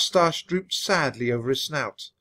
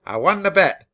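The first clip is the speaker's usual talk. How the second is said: higher, louder crow of triumph; squeaky captain; 5 semitones higher, 6 dB louder than usual. A narrator's reading. Captain gloating to co-pilot about a bet, raised squeak. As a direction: crow it over the losing co-pilot. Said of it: "the captain's voice squeaked".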